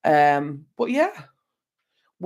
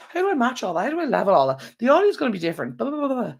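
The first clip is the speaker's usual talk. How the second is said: high mock panic; 8 semitones higher, faster than usual. Stressed rant about editing problems.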